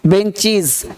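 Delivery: "बेंचीज" falling, with long ee; correct -IZ ending. Shown as a demonstration. Teacher drilling the class, "बेंचीज" ending -cheez. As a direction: say "बेंचीज" with a buzzed final z. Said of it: "ज पढ़ना है".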